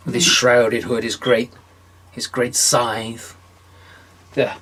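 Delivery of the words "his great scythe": ordinary delivery, nothing marked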